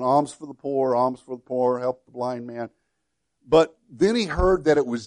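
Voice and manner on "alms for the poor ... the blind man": a mild monotone beggar's drone, not much tune; almost monotone. Retold or imitated. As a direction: drone the plea in a lightly acted near monotone. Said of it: "started out that almost monotone".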